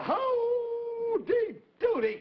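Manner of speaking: high, slow called greeting; drawn-out shout. Acted. Host calls out to the kids, long and high. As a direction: stretch it out and call it to the crowd.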